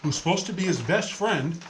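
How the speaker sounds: usual speaking voice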